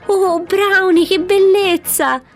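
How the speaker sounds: very high, loud squeal of delight, drawn out at the end; excited shout. A narrator's reading. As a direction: cry out overjoyed for your friend. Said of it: "nell'eccitazione del momento gridò".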